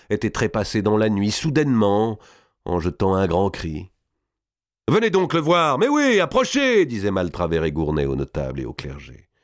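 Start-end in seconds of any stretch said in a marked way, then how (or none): 4.9-6.9 high, raised invitation; calling people over. A narrator's reading. Killers invite notables to view the body, calling out. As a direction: wave them in with the voice, climbing higher.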